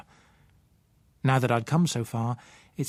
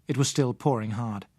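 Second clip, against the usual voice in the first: usual speaking voice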